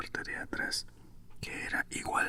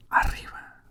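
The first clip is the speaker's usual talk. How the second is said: whispered shout, punched louder; booming command; 6 dB louder than usual. A narrator's reading. Queen ordering gardeners to stand, forceful whispered shout. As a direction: bark the order within a whisper. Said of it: "gritó la reina en voz fuerte y detonante".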